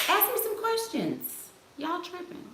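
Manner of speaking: high-pitched, playful whine for attention; mock whiny demand. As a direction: whine at the viewers in a put-on high voice. Mock-pouting demand to her viewers.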